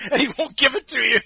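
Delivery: can barely get it out for laughing. Real, not acted. Cohost joking about Vince, laughing as he talks.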